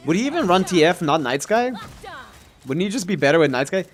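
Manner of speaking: ordinary delivery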